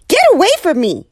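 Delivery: high, loud frightened shoo; alarmed shooing. Acted. Neighbor shooing a pecking penguin, alarmed. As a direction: push the bird off in fright. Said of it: "said Missus Callahan to Captain Cook".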